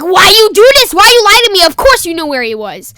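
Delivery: high, very loud yell falling at the end; shouted, hammed-up telling-off; mock angry scolding. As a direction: imitate a mom furious at being lied to. Mom scolds him for lying.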